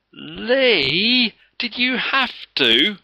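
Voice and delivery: high, loud, slow complaining wail; child wailing at a bully over the stink; outraged cry. A narrator's reading. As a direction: cry out in disgust at a classmate. Children complain about the smell.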